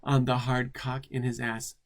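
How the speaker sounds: usual speaking voice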